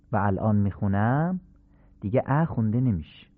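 normal talking voice

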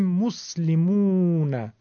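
مسلمون slow, 'mus-li-mooo-na'; stretch contrast. Shown as a demonstration. Teacher showing only length differs, long 'oo' in مسلمون. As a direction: linger on the second 'mu' of مسلمون.

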